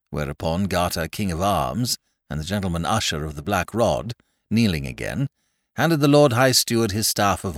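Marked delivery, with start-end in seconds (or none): none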